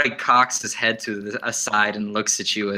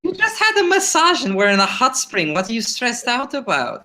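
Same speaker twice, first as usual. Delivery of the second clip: high-pitched exasperated scolding; 12 semitones higher than usual. Acted. Companion to stressed drinker at the hot spring, exasperated.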